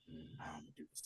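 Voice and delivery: a sly whispered musing to himself; whispered thinking aloud. Retold or imitated. Oz plotting his next move, whispered. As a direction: whisper it as if scheming under your breath. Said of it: "So Oz is like".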